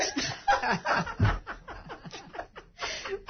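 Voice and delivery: breathy high burst of laughter; airy giggling fit; real laughter. Real, not acted. Laughing at being shushed.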